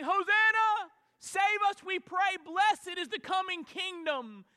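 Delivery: high, loud joyful cry; crowd shouting. Retold or imitated. Crowd hailing the king entering Jerusalem, loud shouting. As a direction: shout it like the palm-waving crowd. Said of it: "They were shouting".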